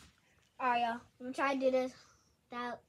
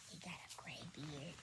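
whisper; 10 semitones lower, 18 dB quieter than usual. Real, not acted. Aside to viewers.

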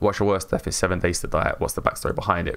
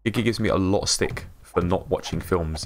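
ordinary talk, nothing marked

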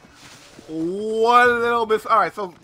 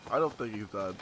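much lower and softer, under the breath; muttered; 12 semitones lower, 10 dB quieter than usual. Real, not acted. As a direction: mutter it to yourself. Repeat muttered to self.

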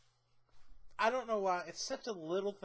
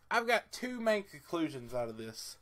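normal talking voice

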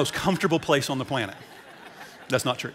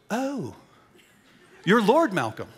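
a jolt of surprise, higher and then louder on the name; surprised realization; 7 semitones higher, 4 dB louder, slower than usual. Retold or imitated. Pastor retelling meeting a Lord at dinner, surprised and high. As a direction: Lord Malcolm answering the table host, voice jumping high with surprise.

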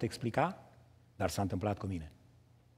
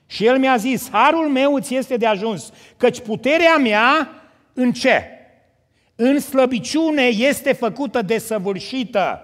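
raised, ringing preacher's voice; preaching at full force; 8 semitones higher, 8 dB louder than usual. Acted. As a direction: proclaim it to the congregation. Preacher reads and exhorts.

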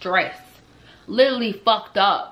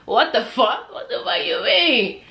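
laughing disbelief; 3 semitones higher, slower than usual. Retold or imitated. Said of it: "I'm just like".

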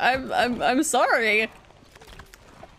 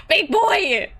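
ordinary talk, nothing marked

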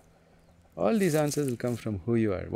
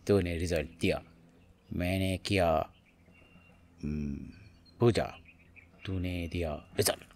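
mock transactional devotee; 5 semitones lower, 3 dB quieter, much faster than usual. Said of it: "Like this".

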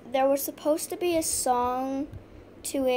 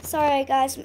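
normal talking voice

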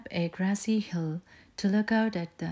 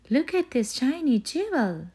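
high, bright little cub crying out in delight; excited cry; 8 semitones higher, 6 dB louder than usual. A narrator's reading. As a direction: cry out with wonder at the find. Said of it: "she cried".

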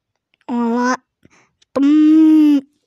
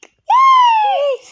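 squeal; 6 dB louder than usual. Acted.

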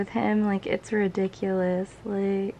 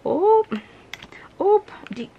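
much higher and louder, squealing; excited squeal; 10 semitones higher, 5 dB louder than usual. Real, not acted. Fan squealing at a new photo card. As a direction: squeal with surprise.

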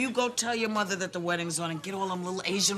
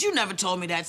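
ordinary delivery

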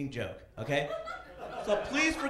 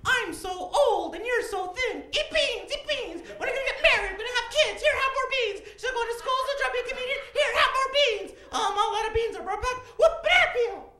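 grandmother's voice; 8 dB louder than usual. Retold or imitated. Comic at the funeral, grandson voices his grandmother, high and loud. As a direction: squeal it out like a tiny old grandma pushing beans. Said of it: "hear her voice in my head going".

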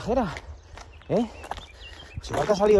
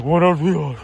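booming, loud macho voice; macho alfa; 6 dB louder, slower than usual. Retold or imitated. Woman on the trail greets him, imitated macho alfa voice. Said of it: "de macho alfa".

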